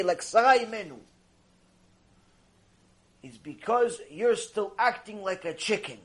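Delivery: usual speaking voice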